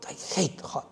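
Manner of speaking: muttered under the breath, a whispered sulky complaint; sulky grumble. Pretend. Teacher acting out a student grumbling about the heat, muttered sulky whisper. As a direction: grumble it to yourself like a sulky commuter.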